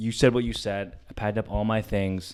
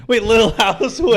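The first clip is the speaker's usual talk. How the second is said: high, loud, baffled laughing question; sudden loud confusion; surprised; 13 semitones higher, 13 dB louder than usual. Real, not acted. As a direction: caught off guard and amused. Confused by friend's odd remark.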